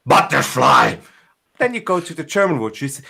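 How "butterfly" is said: loud, slow, forceful angry bark; exaggerated angry shout; really angry. Shown as a demonstration. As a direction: spit the word out in anger. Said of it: "things also really angry".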